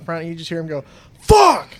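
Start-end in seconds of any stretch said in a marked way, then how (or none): 1.2-1.7 imitated high, loud shout of frustration; super loud. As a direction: yell it like someone fuming in the next room. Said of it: "Super loud".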